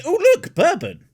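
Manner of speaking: sudden delight. Pretend. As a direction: get wildly distracted. Sudden distraction mid-speech.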